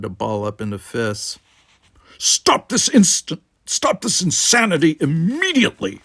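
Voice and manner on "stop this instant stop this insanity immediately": a furious order to stop; commanding shout. Acted. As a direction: roar them into silence. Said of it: "said Worf".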